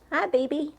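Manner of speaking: high, sing-song greeting to a pet. Real, not acted.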